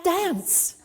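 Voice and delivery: high, playful urging; laughing high call; cheerful command. Retold or imitated. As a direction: coax her to dance. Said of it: "she said".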